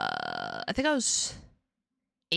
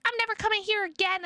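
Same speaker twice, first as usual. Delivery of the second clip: put-on much higher, fast panicked kid; retold child fleeing; 10 semitones higher, much faster than usual. Kid escaping the malamute at daycare, fast high panicked. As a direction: blurt it like a scared child running off. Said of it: "I was like".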